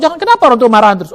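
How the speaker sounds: ordinary delivery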